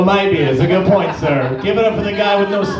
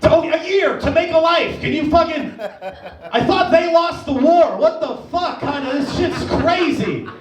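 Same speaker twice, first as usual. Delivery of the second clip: high-pitched comic indignation; exasperated outburst; 7 semitones higher than usual. Acted. Worker with two weeks off to tourist, exasperated. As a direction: cut them off, beside yourself with envy.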